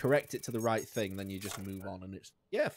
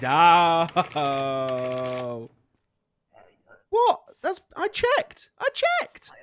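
high, loud cry of protest; shocked disbelief; 5 semitones higher, 10 dB louder, much slower than usual. Real, not acted. Player protests a game penalty in high, loud disbelief. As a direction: wail that you did check.

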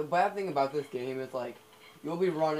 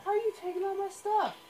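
much higher, put-on whiny squeak; squeaky mock complaint; 4 dB louder than usual. Gamer voicing a character whose stuff is taken, high squeaky whine. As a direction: whine it like a robbed little creature.

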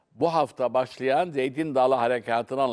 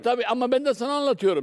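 higher and fast, pressing hard; heated retort; 8 semitones higher, faster than usual. Real, not acted. Guest cutting off the journalist in a heated debate, fast and high. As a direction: fire it back quickly, pitched up.

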